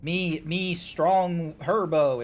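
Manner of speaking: slow, dim-witted caveman talk; dumb brute voice. Retold or imitated. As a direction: play a musclebound meathead, slowly. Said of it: "Not, like, strong of".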